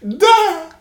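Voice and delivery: sudden high, loud "Duh!" yelp; cousin's fake laugh. Imitating his cousin's random fake laugh, a high loud yelp. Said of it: "every now and then he just goes".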